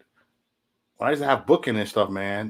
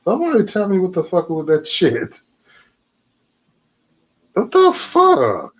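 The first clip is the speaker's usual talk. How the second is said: stunned outburst; 6 semitones higher, 6 dB louder than usual. Real, not acted. Stunned reaction to caller.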